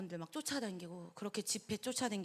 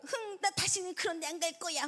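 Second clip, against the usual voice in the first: pouting huff, higher; sulky girl; 7 semitones higher than usual. Retold or imitated. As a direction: imitate a sulking young woman stomping off. Sulking sister-in-law retold.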